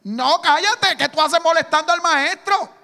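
mock scolding crowd. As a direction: snap at him to shut up. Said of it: "fueron los primeros que allí le dijeron".

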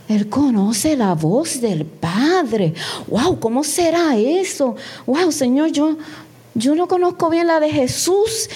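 wonderstruck, with swooping pitch; acted amazement. Listener marveling that the pastor knows the Father's voice, acted amazement. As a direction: marvel aloud, drawing out the big words. Re-enacts her amazed inner reaction.

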